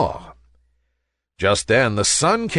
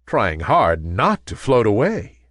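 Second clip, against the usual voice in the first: plain reading voice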